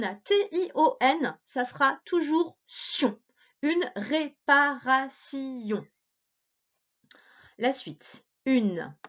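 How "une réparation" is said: slow, "réparation" split into beats; "réparation" said in clear beats; syllable by syllable. Shown as a demonstration. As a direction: read "réparation" along slowly. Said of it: "lire en même temps que moi".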